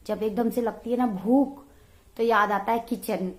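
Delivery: ordinary delivery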